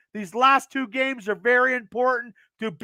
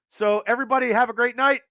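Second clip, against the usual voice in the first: ordinary talk, nothing marked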